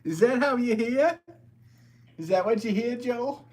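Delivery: loud, high Boston accent impression; exaggerated Boston accent. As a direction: holler it in a hammed-up Boston accent. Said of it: "Do you hear a Boston accent in my normal voice?".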